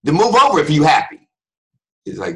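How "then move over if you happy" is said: high, loud irritated order; hammed-up angry bark; angrily happy. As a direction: order them aside, mock furious. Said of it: "they look angry".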